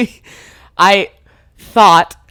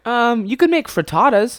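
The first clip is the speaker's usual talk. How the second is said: higher-pitched, tentative suggestion; imitating helpful friend; 7 semitones higher than usual. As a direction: offer it up in the friend's lighter voice. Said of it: "'Cause he went".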